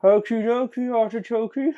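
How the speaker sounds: high, silly sing-song catchphrase. Pretend.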